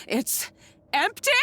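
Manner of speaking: can't believe what you're seeing; disbelieving cry. Acted. Disbelief at empty jar.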